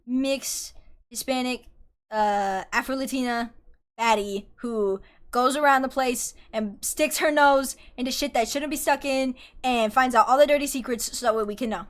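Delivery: ordinary talk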